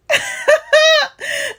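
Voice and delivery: a sharp high burst of laughter; high cackle after a bold claim; sudden high laugh. Real, not acted. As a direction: let out a quick shrieking laugh.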